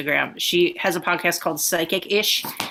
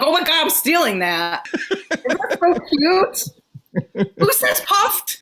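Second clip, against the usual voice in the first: much higher, slow, delighted squeal; 10 semitones higher than usual. Real, not acted. Host gushing to her co-host about the word puffed, high delighted squeal. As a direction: gush over a cute new word.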